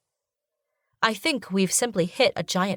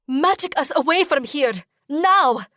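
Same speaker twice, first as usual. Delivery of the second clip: high, loud urgent command; shout; 8 semitones higher, 4 dB louder than usual. A narrator's reading. Heroine shouting at the genie, urgent. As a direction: yell the order at him. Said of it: "I shout".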